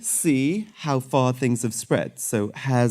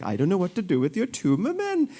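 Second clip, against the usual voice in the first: light mock Indian accent, a bit softer; 7 semitones higher, 3 dB quieter than usual. Surgeon joking with an Indian patient in an Indian accent. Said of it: "they're from, you know, India".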